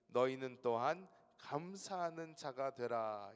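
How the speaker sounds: slow, loud pulpit reading; solemn scripture reading. Acted. Pastor reading Colossians to congregation, slow solemn proclamation. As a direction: proclaim the verse slowly.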